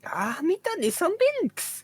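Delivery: very high, slow, squeaky alien voice; Jar Jar Binks voice. Pretend. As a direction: stretch it out high like a goofy alien. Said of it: "Jar Jar Binks?".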